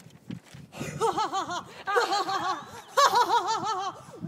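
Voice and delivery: loud harsh mocking laugh; long scornful laugh; hard bitter laugh. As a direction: bark out a bitter laugh at the city. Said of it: "With a hard, bitter laugh".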